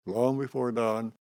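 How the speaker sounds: normal talking voice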